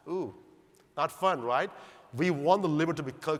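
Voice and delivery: usual speaking voice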